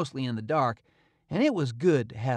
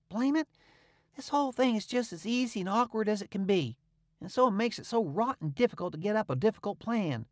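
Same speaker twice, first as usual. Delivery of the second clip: put-on higher, grumbling boy's complaint; kinda dissatisfied; 7 semitones higher than usual. A narrator's reading. Tom complaining to Huck, dissatisfied. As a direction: sound fed up with how easy it is. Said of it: "kinda dissatisfied".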